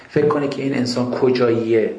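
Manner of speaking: normal talking voice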